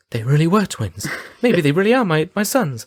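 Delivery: rising high realisation; dawning mum. Retold or imitated. The mother realising the twins are her sons. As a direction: pitch climbs as it dawns. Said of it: "she's like".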